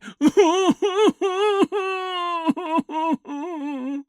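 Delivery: high, drawn-out wailing sob; hammed-up wavering ghost cry; mock ghostly sobbing. Host acting the cry heard across the graveyard, mock sobbing. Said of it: "they hear a deep, sobbing cry".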